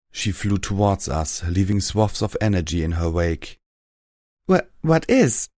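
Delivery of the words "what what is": high, halting, slow gasped question; stunned, fearful. A narrator's reading. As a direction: stammer it out in shock. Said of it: "my mouth had gone dry".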